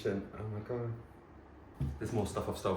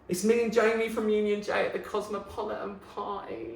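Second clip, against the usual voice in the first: excited; 13 semitones higher, 4 dB louder than usual. Real, not acted. Showing an old photo with Jamie, high and excited. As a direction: gush like you've found treasure.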